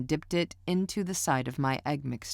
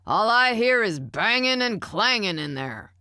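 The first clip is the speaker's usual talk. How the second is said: loud, high complaining yell; yelling from another room; 7 semitones higher, 6 dB louder than usual. A narrator's reading. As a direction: shout it from the recliner. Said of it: "Stanley yelled from his recliner".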